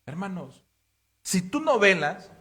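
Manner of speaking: fuerte y alto, exhortando a la congregación; predicación a toda voz. Acted. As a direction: clama como pastor desde el púlpito. Predica con fuerza. (el pastor exhorta a la congregación, predicando a toda voz)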